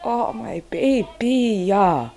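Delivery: slow, soft tender cooing. Retold or imitated. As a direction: melt over your baby, slowly. Her tender reply to baby.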